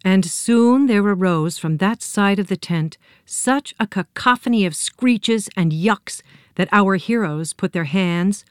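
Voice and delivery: plain reading voice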